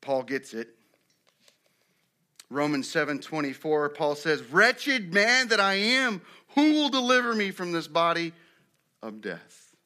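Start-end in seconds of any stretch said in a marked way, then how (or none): 4.4-8.3 anguished cry. Acted. Paul lamenting his sin in Romans, anguished cry. 9.0-9.7 low, soft drop after a cry; hushed despair. Acted. Paul ending his lament over sin, hushed despair.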